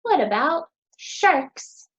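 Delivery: ordinary talk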